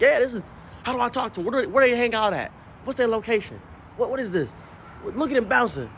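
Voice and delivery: put-on high, rushed, giddy old man; excited granddad. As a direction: gush like a smitten grandpa. Granddad excited watching femboys.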